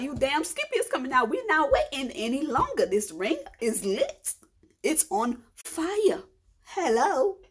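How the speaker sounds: hammed-up exasperated mother in labour; sassy exasperation. Retold exasperated reply to nurse.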